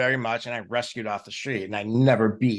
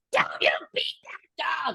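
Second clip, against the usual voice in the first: hushed start, then a sudden jump to a high, shrill-pitched scold; scolding neighbor lady. Retold or imitated. Neighbor scolding the owner over the dog, mock outrage. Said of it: "And the neighbor's like".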